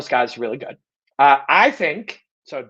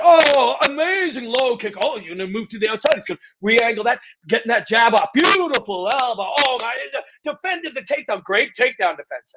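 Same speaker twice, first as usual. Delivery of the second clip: higher, hyped-up fight commentator calls with a shout; mock newscaster play-by-play; 8 semitones higher than usual. Mocking the newscaster he'd have to become, hyped play-by-play. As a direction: ham up a canned broadcaster calling the fight. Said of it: "I too will be a newscaster".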